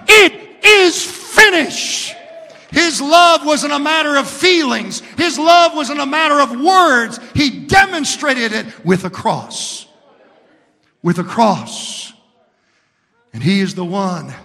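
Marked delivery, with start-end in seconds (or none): 0.1-2.1 loud, slow, high cry. A narrator's reading. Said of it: "he cried out on the cross". 2.7-12.1 loud, rising preacher's cry; fiery preaching. Acted. Preaching at full force.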